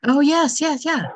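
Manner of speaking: high, loud eager agreement; excited. Real, not acted. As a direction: burst out in recognition. Recognizes Jay Shetty eagerly.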